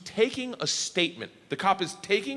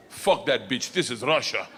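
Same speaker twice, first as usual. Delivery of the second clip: put-on Russian accent; 4 semitones lower than usual. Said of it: "and goes".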